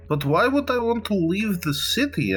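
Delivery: high-pitched mock pondering; show of thinking. Player makes a show of weighing the smuggler's offer, mock pondering. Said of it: "He's going to make a show of, like, thinking".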